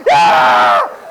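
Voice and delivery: loud terrified yelp; mock scream. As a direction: let out a comic scream of panic. Imitates own scream while trying calm.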